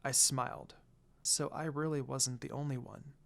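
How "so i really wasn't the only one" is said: soft hushed realization; whisper. A narrator's reading. Lucy watching Mochi care, a soft relieved whisper. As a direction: breathe it softly to yourself. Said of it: "I whispered".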